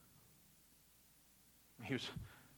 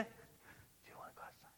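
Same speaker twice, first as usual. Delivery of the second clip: whisper; 25 dB quieter than usual. Retold or imitated. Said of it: "quite like a whisper".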